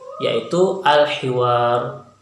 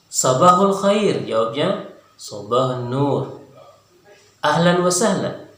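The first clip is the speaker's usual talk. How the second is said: modelled dialogue reading; 4 semitones higher than usual. Shown as a demonstration. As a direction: model each greeting clearly for learners. Teacher models dialogue again.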